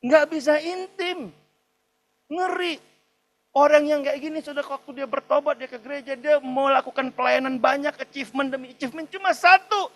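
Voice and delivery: high, loud emphatic sermon delivery; forceful preacher in full flow; impassioned preaching. Real, not acted. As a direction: drive the point home with rising force. Preacher driving the point home.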